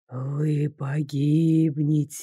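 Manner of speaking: low, slow, triumphant ghost voice; gloating ghost. A narrator's reading. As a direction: gloat like a spirit sure of victory. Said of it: "заранее торжествовал призрак".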